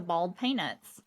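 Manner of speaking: "bald peanuts." as BAWLD PAY-nuts; Southern "bald peanuts." without the OY; Southern pronunciation. Shown as a demonstration. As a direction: flatten boiled into "bald peanuts.". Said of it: "it turns into bald peanuts".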